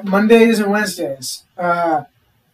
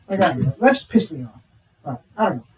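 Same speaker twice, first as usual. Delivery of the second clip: usual speaking voice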